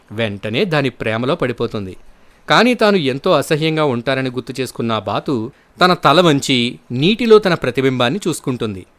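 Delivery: plain reading voice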